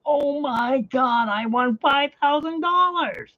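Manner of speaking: barely believing the five thousand; overwhelmed disbelief. Retold or imitated. Telling friend Alan on the phone, slow high overwhelmed joy. Retelling the big win.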